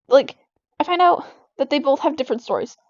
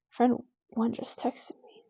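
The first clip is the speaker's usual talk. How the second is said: slow, hushed real whisper; very soft, voiceless aside; 2 semitones lower, 10 dB quieter, slower than usual. Real, not acted. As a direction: whisper it slowly, as a private aside. Quiet aside as text arrives.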